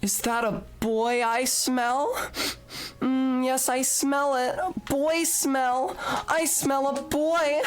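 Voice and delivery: put-on high, slow breathless girly voice; reading redeem text. Reading the boy smell green text to chat in a high giddy voice. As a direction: gush over the boy smell. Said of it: "That teaches me to try to ad lib".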